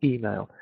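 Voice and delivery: email with the first E stressed; stress on first sound. Shown as a demonstration. Teacher repeating email for students, first sound stressed. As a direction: hit the E of email. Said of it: "So you stress that first sound".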